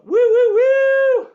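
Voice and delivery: very high, very loud whoops; excited whooping. Real, not acted. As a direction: whoop like a cheering crowd. Hyped after thanking sister.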